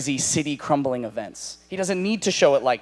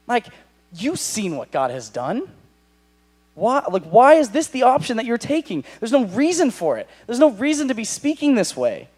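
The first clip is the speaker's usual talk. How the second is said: ordinary delivery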